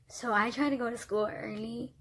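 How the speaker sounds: normal talking voice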